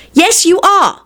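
high-pitched sibling retort; insistent contradiction. Acted. Siblings quarrel back and forth.

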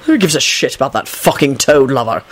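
low-pitched angry rebuke; furious yell. A narrator's reading. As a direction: growl it with held-in fury. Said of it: "Quentin yelled furiously".

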